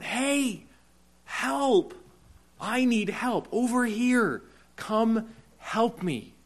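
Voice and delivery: high, straining cry for help, slowing at the end; high pleading shout of help, drawn out at the close; desperate yell for help. Retold or imitated. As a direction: call out like a drowning man to the shore. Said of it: "You yell, you cry out".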